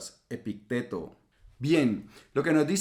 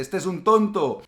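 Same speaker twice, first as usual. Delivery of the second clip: higher, louder insulting yell; imitating angry driver; 6 semitones higher, 6 dB louder than usual. Another driver insults him on the road, angry mock shout. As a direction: hurl the insult like a furious driver.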